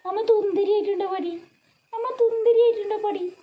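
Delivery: high sing-song cooing; high cooing motherese. Real, not acted. Dressed-up mother cooing at baby.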